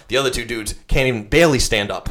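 normal talking voice

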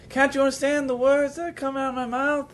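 high, slow movie-line imitation; Jackie Chan. Actor repeats his Rush Hour line, high mock delivery. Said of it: "He said one of his lines".

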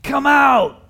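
loud cry. Acted. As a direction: call the dead man out of the tomb. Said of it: "in a loud voice he cries out".

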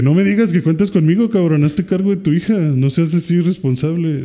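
retold scolding with a lifted voice; scolding Piccolo. Piccolo chewing out Gohan for neglecting his daughter, scolding. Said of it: "Y Picoro de que".

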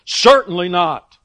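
loud, emphatic CERTAINLY NOT; emphatic statement. Retold or imitated. Preacher voicing Paul to get listeners' attention, emphatic. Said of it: "making these little emphatic statements to get their attention".

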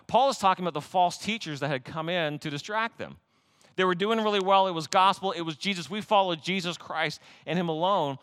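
normal talking voice

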